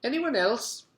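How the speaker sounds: a lightly voiced kindly teacher; sweet teacher. A narrator's reading. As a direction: coax the class gently. Mister Gorf voice.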